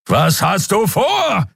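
high, slow shouted question; frantic yell at the pilot; bellowing. Acted. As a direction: yell it over the engine noise. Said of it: "brüllte Kero wieder".

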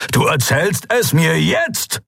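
a touch of a father's bark; barked demand. Acted. Father angrily demanding answers from Lien, mild bark. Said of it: "bellte er".

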